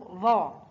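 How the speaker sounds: ظا sounded out as a model syllable; ظا with fatha. Shown as a demonstration. Teacher showing learners the letter with fatha, ظا sounded out. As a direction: say ظا as an emphatic interdental with an open a. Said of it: "حرف الظاء محرك بحركة الفتح ينطق".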